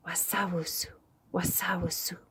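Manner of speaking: hissed, breathy whispered sound; snake whisper. Acted. Snake announcing itself to Koi in a whisper. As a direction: whisper it like a snake slithering near.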